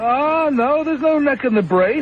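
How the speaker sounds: squeaky comic persona needling a guest; falsetto comic character. Acted. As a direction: tease the caller in a silly high voice. Host's put-on character voice.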